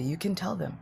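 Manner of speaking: ordinary talk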